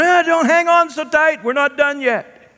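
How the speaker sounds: high, loud laughing tease; Jesus playful. Acted. Jesus joking as Mary clings to him, high. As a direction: tease her off the hug.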